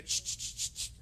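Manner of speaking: repeated sharp "shh" noises to call someone; short voiceless hisses, like calling a dog; hissed "ch" summons. Acted. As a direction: imitate rudely hissing for a waiter. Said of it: "para qué le diga así".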